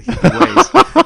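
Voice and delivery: high, loud, slow dreamy hippie put-on; drawn-out spacey hippie parody; mock hippie voice. Host teasing listeners with a high, slow mock hippie voice. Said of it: "this kind of hippie vibe".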